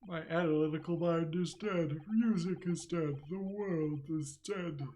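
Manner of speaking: a tragic genius mourning his lost gift; melodramatic despair. Acted. To his friends over his failing violin, theatrical despair. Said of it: "You look miserable".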